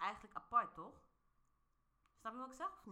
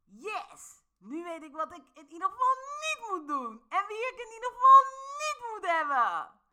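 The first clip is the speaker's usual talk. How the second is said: high, bright mock positivity, getting louder; cheerful reframe that grows loud and high; upbeat reframe; 11 semitones higher, 10 dB louder than usual. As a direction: sell the silver lining, building.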